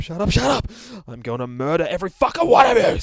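loud, high enraged yelling; furious teddy. Acted. Bear yells at laughing monsters, furious loud rage. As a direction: lose it and bellow threats.

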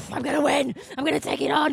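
loud, mock-fierce battle cry; hammed-up competitor psyching up; fiercely competitive.

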